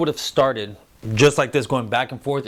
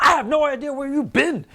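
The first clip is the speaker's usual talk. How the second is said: high-pitched mock protective outburst; hammed-up high panic over the babies; overprotective; 11 semitones higher than usual. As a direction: imitate a fussing, overprotective dad. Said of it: "gets a little overprotective".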